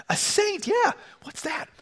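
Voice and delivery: high-pitched puzzled questions; softly squeaked surprise; baffled. Acted. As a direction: pipe up, lightly acted bewilderment. Acted Peter baffled by Jesus' news.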